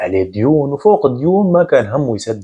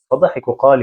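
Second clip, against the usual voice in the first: plain reading voice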